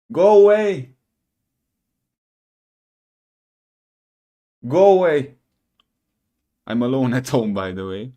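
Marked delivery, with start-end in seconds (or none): 0.1-5.4 high loud yell at the dark; shouted go away. Real, not acted. Shooing house noises.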